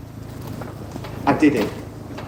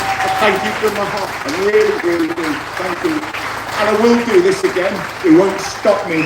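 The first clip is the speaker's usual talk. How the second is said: higher and louder, heart on his sleeve; emotional outpouring; 7 semitones higher, 4 dB louder than usual. Real, not acted. Speaker thanking the crowd, rising to a higher, louder emotional cry. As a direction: swell up and pour out every word of thanks. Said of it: "sorry, I'm my own emotional person".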